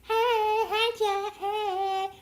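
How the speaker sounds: high, loud cooing at the pet. Real, not acted. Greeting her pet.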